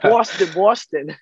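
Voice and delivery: Boston said in a thick, slow Boston accent, r dropped; Boston stretched out in a hammed-up Boston accent; Boston drawled high in a mock Boston accent. Shown as a demonstration. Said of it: "You gotta say it with the accent".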